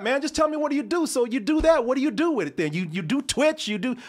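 high, loud mock badgering; hammed-up demanding questions; imitating a pushy guy. Stranger pressing the host about his work, mock pushy and high.

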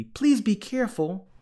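high, anxious appeal; fretful storybook warning; worried plea. A narrator's reading. Younger sister pleads.

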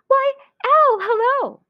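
very high, loud cheery greeting; squeaky-high mouse welcome. Acted. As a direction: sing out hello as a little mouse.

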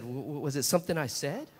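low, stammering, puzzled question; bewildered husband. Retold or imitated. Husband after his wife slammed the door, sheepishly bewildered. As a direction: stammer it softly, baffled.

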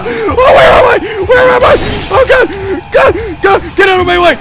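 loud, high frantic yelling; panicked shouting. Real, not acted. Player fleeing the monster, frantic loud shouts. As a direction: shout in blind panic while running.

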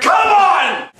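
strained, rising to a high shout; opening a stuck jar. Acted. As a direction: fight the lid and yell at it.

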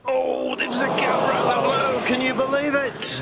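high thrilled commentator burst; excited. Real, not acted. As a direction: rise with sudden disbelief. Gap opens, commentator thrilled.

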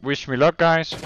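ordinary delivery

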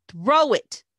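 loud bark, pitch leaping up and dropping; barked order. A narrator's reading. Bully repeating his order after she refuses, sharp loud bark. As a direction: bark it, out of patience. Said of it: "Red barked".

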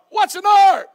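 loud, high, incredulous shout; scoffing shout. Acted. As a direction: yell it like the idea is absurd. Mocker scoffs at Noah.

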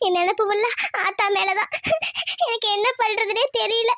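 sobs between phrases; crying. Acted. Homesick elder sister thinking of her mother, crying. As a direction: weep through the words, pitch lifting. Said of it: "அக்கா, அழாதக்கா".

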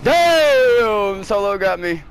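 a sudden loud high yell of shock; startled shout. Real, not acted. Gamer hit by a rival in the race, loud shocked yell. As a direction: cry out as you get knocked out.

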